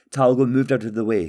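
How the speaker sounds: ordinary delivery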